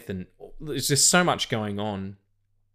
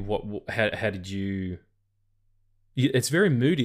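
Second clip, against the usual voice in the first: ordinary talk, nothing marked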